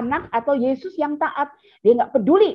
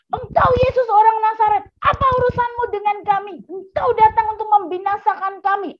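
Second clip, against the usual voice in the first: possessed man's shriek; 10 semitones higher than usual. Acted. The possessed man cries out at Jesus in a high demonic shriek. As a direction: shriek like the demon inside him. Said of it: "mereka lalu kemudian berteriak".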